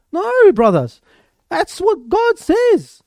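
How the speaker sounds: high, slow ringing declaration; fervent cry. Real, not acted. Preacher to the brothers, high fervent cry.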